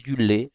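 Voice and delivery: lait said with a closed é, as "lé"; closed é variant. Shown as a demonstration. Teacher showing another way to say it, closed é. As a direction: du lait with a tight é like in été. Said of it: "Il y en a qui disent".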